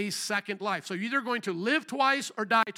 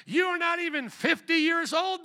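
high-pitched, scoffing objection; incredulous challenge; 8 semitones higher than usual. Acted. Crowd objecting to Jesus's claim, incredulous. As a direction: throw it back in disbelief. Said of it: "they said to him".

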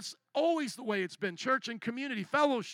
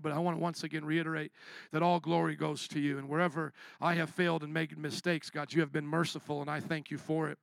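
soft, lower prayer voice; closing prayer; 5 semitones lower, 3 dB quieter than usual. Acted. Pastor praying over the cohort, soft and humble. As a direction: pray humbly, close to God.

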